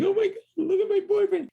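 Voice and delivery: very high distraught girlfriend imitation; hammed-up high-pitched distress; mock crying. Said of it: "the girlfriend's crying".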